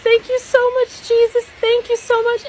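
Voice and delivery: high, emotional thank-you, retold; overjoyed thanks. Grateful cry to Jesus in dream.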